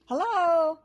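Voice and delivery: high, loud hedgehog holler. A narrator's reading. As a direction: shout it up the tree. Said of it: "he hollered out again".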